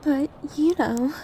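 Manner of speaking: ordinary talk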